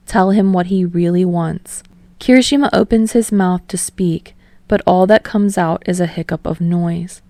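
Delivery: ordinary delivery, nothing marked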